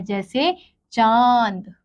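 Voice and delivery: चांद slow with a long nasal vowel; nasalized vowel. Shown as a demonstration. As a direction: draw the nasal aa through the nose in चांद. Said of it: "नाक और गले दोनों से निकलती है". (चांद को नाक से धीरे बोलना)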